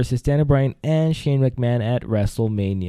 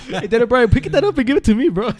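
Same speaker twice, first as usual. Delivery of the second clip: calling out high and louder through laughter; laughing plea; 10 semitones higher, 3 dB louder than usual. Real, not acted. Host laughing, asks Daniel Bryan for his blazer. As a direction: beg for the blazer while cracking up.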